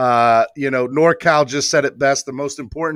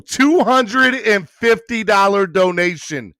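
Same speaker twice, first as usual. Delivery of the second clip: slow, higher, stretched-out hype; proud, drawn-out shout-out; excited announcement; 7 semitones higher, 3 dB louder, slower than usual. Real, not acted. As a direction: savour every word of the amount. Announcing the donation to viewers.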